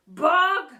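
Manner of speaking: loud bark; barked name. A narrator's reading. Teacher barking at the boy, loud. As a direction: snap the name. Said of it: "barked the teacher".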